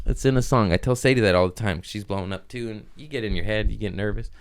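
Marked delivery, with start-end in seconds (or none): none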